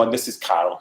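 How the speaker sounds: ordinary delivery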